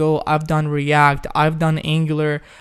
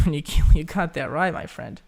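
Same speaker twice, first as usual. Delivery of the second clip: normal talking voice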